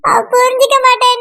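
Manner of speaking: usual speaking voice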